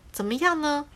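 lightly nervous question; the sparrow nervously asks the lemon for his answer; a touch of nervousness. Acted. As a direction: ask a little anxiously. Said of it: "麻雀就很紧张地问他说".

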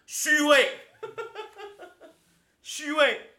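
loud, high laughing cry of "fake"; laughing mock jeer. Real, not acted. Mocking a fussy coworker, loud laughing jeer. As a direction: burst out jeering through laughter.

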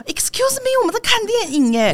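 音调高高拔起，阴阳怪气地提醒; 故作客气又带刺地说给吵闹的情侣听; sarcastic complaint. Retold or imitated. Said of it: "一直用气音讲话". (对影院里吵闹的情侣阴阳怪气地抗议)

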